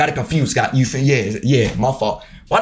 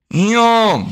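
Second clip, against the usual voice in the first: normal talking voice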